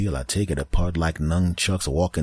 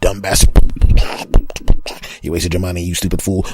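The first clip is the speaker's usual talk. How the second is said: louder scornful sneer at the owner; sneering earphone voice; 9 dB louder than usual. Pretend. Earphone taunting its owner, a louder scornful sneer. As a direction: insult him like a smug gadget. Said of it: "go back to the other".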